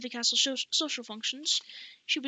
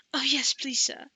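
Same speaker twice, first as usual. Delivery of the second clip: breathless; 2 semitones higher, 6 dB louder, slower than usual. Acted. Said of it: "replied breathlessly".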